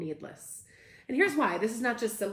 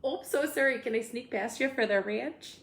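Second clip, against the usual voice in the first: higher-pitched Midwestern politeness; apologetic Midwesterner squeezing past; Midwestern accent; 4 semitones higher than usual. Acted. Host playing someone sneaking past for the ranch, Midwestern accent. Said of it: "This is my Midwestern".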